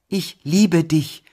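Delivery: high, loud and very slow; brave declaration. A narrator's reading. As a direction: declare it bravely, stretching each word. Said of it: "wiederholte ich tapfer". (tapfer, laut und gedehnt)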